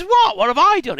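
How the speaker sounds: very high, loud squeak of innocence; mock-innocent surprise. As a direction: act wounded and baffled. Laban feigns innocence.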